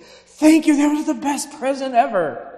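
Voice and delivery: put-on high, loud, over-the-top kid voice; gushing thanks. Nephew thanking Grandma for a gift, high and gushing. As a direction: blurt the thanks like an overexcited child. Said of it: "I was like".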